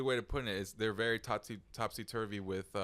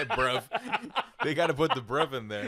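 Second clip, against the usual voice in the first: laughing; 6 semitones higher, 9 dB louder than usual. Real, not acted. Laughing at the bruv story.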